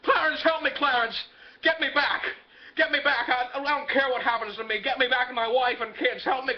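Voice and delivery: acted desperate appeal; desperate shouting. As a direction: cry out for help, begging. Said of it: "takes off back to the bridge shouting".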